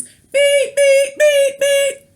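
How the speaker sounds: high, loud mock beeps; beacon beeping. Aquarius beacon sending out signals with high, loud beeps. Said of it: "we're beacons".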